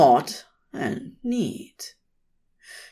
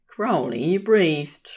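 ordinary delivery

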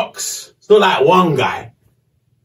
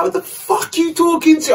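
high, furious retold yell at the men; angry yell; 13 semitones higher than usual. To inmates with rocks, a furious high yell. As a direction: snap back at them, pitch way up. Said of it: "I went hackney on them".

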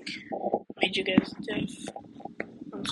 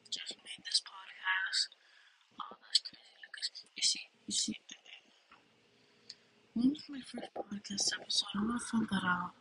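hushed whisper; 7 dB quieter than usual. Real, not acted. Podcasting to viewers at night, whispering quietly. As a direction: keep it down late at night.

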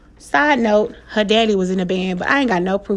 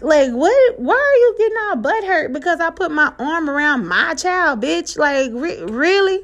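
higher-pitched retold indignation; indignant disbelief; 7 semitones higher than usual. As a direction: challenge him in raised, incredulous pitch. Mom's indignant reaction to dad, retold.

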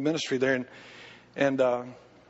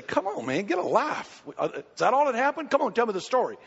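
dismissive brush-off; 6 semitones higher than usual. Retold or imitated. Steve brushes off petty complaint.

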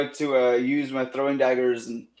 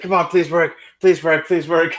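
desperate pleading; 4 semitones higher, 4 dB louder than usual. Acted. Character pleads with magic cloak.

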